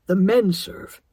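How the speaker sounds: sharp and loud, cutting her off; a sharp, loud correction; father sharply shutting down his daughter. A narrator's reading. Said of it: "Dad said sharply".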